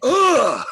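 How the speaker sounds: loud, high "Ugh!" of a stunned audience; stunned crowd groan. Retold or imitated. Guest imitating an amazed audience, loud high groan. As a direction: groan like a room that just got fooled. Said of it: "the whole room goes".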